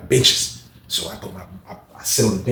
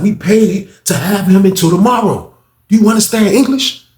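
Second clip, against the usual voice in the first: higher and louder, spelling it out; impatient higher repeat; exasperated repeat; 10 semitones higher, 7 dB louder than usual. Retold or imitated.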